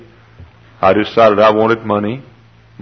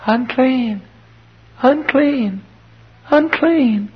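put-on very high, drawn-out child's call; thin out into a high girl's warning cry; little girl's cry; much slower than usual. Leper's little daughter warns others, crying out high. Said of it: "that little girl cries".

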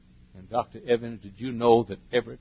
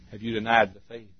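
usual speaking voice

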